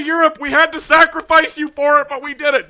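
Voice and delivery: loud, high gloating apology; jokingly shouting an apology to Europe; gleeful shout. Real, not acted. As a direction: still cheering while saying sorry.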